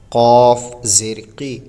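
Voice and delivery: slow drawn-out spelling of syllables, harf by harf; spelled-out Quran reading. Shown as a demonstration. Teacher spelling the word slowly for students. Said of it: "اس طریقے سے پڑھیں گے".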